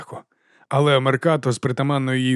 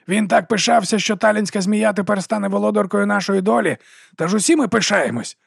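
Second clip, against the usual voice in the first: fake patriotic gush; 8 semitones higher than usual. A narrator's reading. Morvir fakes patriotic enthusiasm.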